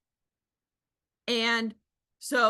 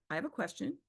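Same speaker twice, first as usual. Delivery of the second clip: calm confident ask; 4 semitones lower, 6 dB quieter, faster than usual. Shown as a demonstration. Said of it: "there's a difference between".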